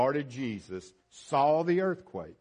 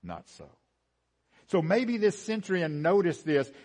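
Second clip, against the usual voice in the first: normal talking voice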